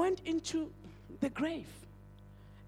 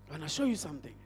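ordinary delivery

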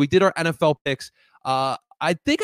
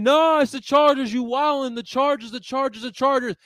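mock fans; 8 semitones higher, 3 dB louder than usual. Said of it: "y'all were saying".

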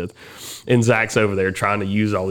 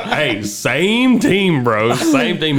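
higher, slow, laughing protest; laughing plea; 7 semitones higher, 4 dB louder, much slower than usual. Retold or imitated. Coworker pleading with his rival to stay teammates, laughing. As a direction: beg him to ease off while laughing.

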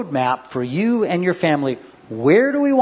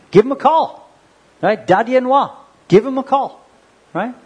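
loud urging; 8 semitones higher, 5 dB louder than usual. Real, not acted. Urging parents to phone kids.

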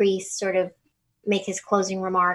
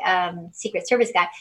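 ordinary delivery

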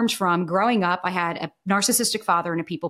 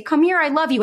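a hint of a high, sweet coaxing parent, a bit louder; 8 semitones higher, 4 dB louder than usual. Abusive parent luring the child in, mildly high and sweet. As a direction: call the child in lovingly. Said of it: "your parents say".

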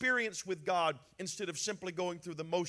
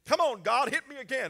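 mock defiant taunt; 6 semitones higher, 8 dB louder than usual. Mock plea for punishment.

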